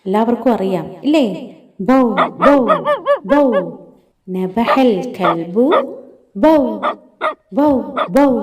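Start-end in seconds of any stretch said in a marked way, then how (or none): 1.8-3.7 short, separate bow-wow barks; dog imitation. Shown as a demonstration. Teacher shows how the dog sounds, barking. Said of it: "കൈഫ നബഹ അൽ കൽബ്?". 6.4-8.3 short, separate bow-wow barks; dog imitation. Shown as a demonstration. Teacher shows how the dog sounds, barking. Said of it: "കൈഫ നബഹ അൽ കൽബ്?".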